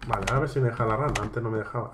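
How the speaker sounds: usual speaking voice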